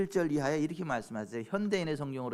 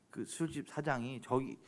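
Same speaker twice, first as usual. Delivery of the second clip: ordinary talk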